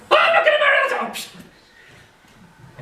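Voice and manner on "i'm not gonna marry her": very high, loud shrieking refusal; Freya screaming. Acted. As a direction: scream it in outrage.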